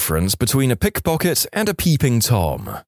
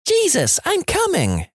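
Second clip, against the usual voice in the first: mock screaming. [name] quotes mommy's cries, high mock scream. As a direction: squeal it as an imitated cry.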